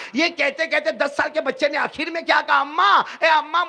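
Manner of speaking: put-on boy pleading to his mother, rising to a cry; building plea. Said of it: "روتے روتے کہا".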